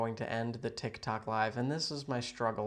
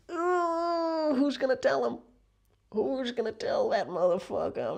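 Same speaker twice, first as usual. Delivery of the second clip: gossipy falsetto; 13 semitones higher, 5 dB louder than usual. Pretend. Gossipy reaction to viewer joining.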